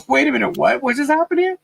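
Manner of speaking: high, baffled disbelief; surprised. Retold or imitated. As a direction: act stunned and confused. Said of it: "Wouldn't it be so like".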